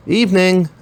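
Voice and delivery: put-on called out high, an elderly shopkeeper's greeting; high sing-song greeting from an old woman; old woman calling out. A narrator's reading. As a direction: greet the customer from behind the counter, pitched high.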